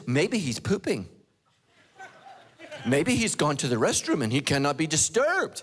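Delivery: mock-concerned taunt with big pitch swings. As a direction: tease them with exaggerated speculation. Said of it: "Elijah starts mocking them".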